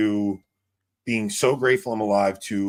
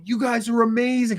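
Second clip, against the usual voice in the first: excited praise; 12 semitones higher, 4 dB louder than usual. Retold or imitated. Quoted praising customers.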